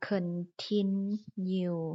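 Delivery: continue in slow syllables, TIN stressed; stress on second syllable. Shown as a demonstration. Teacher shows students where continue is stressed, stress on TIN. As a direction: say continue slowly as kern-TIN-yu. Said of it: "แล้วก็มาเน้นเสียงบนว่า".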